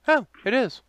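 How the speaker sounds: high imitation of a wife; wife's reaction. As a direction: put on a high-pitched wife's voice. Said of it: "my wife pauses and goes".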